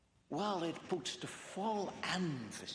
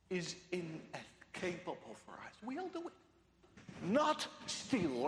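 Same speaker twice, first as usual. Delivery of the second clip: usual speaking voice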